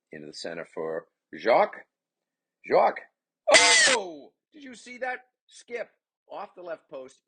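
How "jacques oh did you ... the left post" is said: very high screamed oh, then high amazement; thrilled goal call. Real, not acted. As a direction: explode with excitement at the goal. Calling a spectacular goal.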